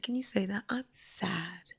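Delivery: hushed, whispery gentle prompt; near-whispered motherly example; tender coaxing. Retold or imitated. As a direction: coax a hurting child very softly. Coaxing son to say it.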